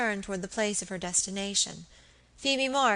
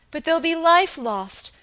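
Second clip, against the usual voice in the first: higher, louder distressed plea; distraught; 9 semitones higher, 8 dB louder than usual. Acted. Frightened girl fearing deaths, distressed. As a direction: let the fear well up. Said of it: "sobbed the girl distractedly".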